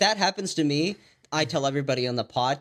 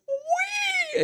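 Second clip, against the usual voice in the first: high squeal. Retold or imitated. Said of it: "he's just like".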